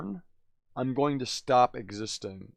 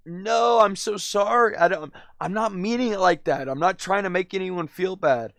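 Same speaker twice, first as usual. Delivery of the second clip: retold panicked apology; 5 semitones higher, 4 dB louder than usual. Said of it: "I was like".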